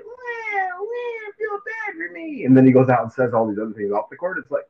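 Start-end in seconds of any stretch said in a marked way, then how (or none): 0.0-2.4 very high, slow mocking whine; whiny crybaby. Mocking a player who whines about calls, in a high crybaby whine. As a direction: wail it like a sulking toddler. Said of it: "someone out there like".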